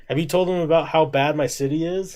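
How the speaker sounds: lightly voiced persistent guy; imitating him, persistent. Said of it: "he would come in and be like".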